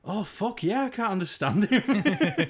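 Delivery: high, loud, laughing exclamation; amused realization. Retold or imitated. Reacting to friend's dad's speech, amused realization. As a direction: draw it out and break into laughter. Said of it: "I've just been like".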